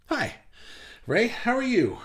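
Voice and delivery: ordinary talk, nothing marked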